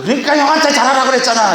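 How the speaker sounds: high, fast mock scolding; scolding parent. Parent turning on the beaten younger child, high rapid scolding. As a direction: rattle out a high-pitched I-told-you-so. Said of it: "그래도 한참 혼내고 나면".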